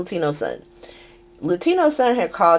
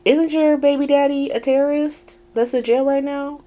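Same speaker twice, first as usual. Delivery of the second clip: higher, sassy clapback; sassy comeback; 8 semitones higher than usual. Retold or imitated. Lucas firing back at Lulu, sassy. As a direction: throw it back with sass. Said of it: "he was like".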